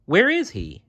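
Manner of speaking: put-on high, searching child's voice; boy looking for the king; eager boy. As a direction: ask it craning to see. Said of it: "asked Jerem".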